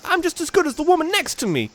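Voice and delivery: high-pitched street-tough woman; female Team Skull grunt. Acted. Reading a Team Skull grunt's line, high-pitched put-on voice. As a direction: put on a high voice, stretching the words out.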